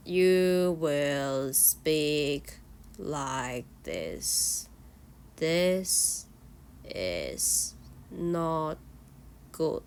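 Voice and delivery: low, slow, flat drone with no tune; flat monotone demo. Shown as a demonstration. Teacher showing students the bad flat way to speak, low slow monotone. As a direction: drag it out dull and lifeless on purpose. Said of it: "What's opposite is don't do this".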